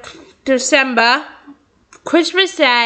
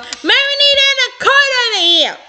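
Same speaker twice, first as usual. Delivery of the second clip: high yell; yelling over alarm; 12 semitones higher than usual. Acted. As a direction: shout across the room over the alarm. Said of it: "yelling over the alarm".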